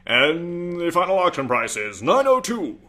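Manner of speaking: bang the gavel on the final price; hammed-up auction announcer; auctioneer. Mock auction close over music.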